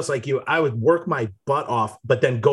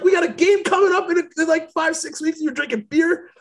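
much higher incredulous coach voice; 14 semitones higher than usual. Retold or imitated. Imitating the coach reminding them of the game, high and outraged.

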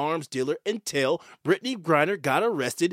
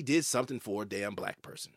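voiceless, hushed aside, a little softer; whispered aside; 10 semitones lower, 10 dB quieter than usual. Real, not acted. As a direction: lean in and let the real reason slip out in a whisper. Pointed aside about the real motive.

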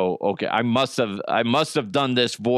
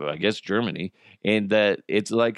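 normal talking voice

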